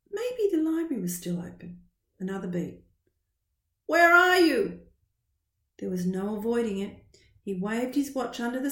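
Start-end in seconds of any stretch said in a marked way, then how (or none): 3.9-4.8 high, loud demand; impatient call. Acted. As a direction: call out, urgent. Mum's impatient message.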